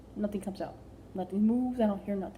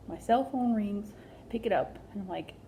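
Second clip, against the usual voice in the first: usual speaking voice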